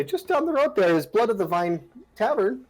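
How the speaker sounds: put-on high, sweet old woman voice; old granny voice. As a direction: croon it like a kindly old granny. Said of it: "She'll say".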